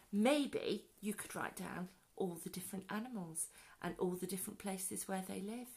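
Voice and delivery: ordinary delivery, nothing marked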